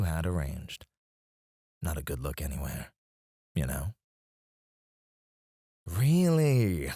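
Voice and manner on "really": high, drawn-out disbelief; raised-pitch incredulity; incredulous. Acted. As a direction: scoff in disbelief with a swooping pitch. Boss incredulous at victim's complaint.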